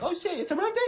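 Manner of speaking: very high startled gasp of a question; sudden surprise. Retold or imitated. Streamer realizing it is a viewer's birthday, surprised. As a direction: caught off guard, realizing too late.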